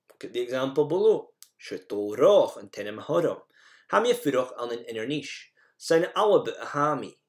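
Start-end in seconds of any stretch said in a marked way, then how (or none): none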